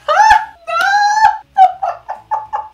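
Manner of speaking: very high, very loud laughing shriek; shrieking scream. Real, not acted. Watching her friend Rapunzel clip, a laughing scream. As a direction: scream it out in shocked delight.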